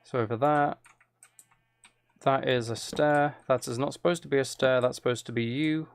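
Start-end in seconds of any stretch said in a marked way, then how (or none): none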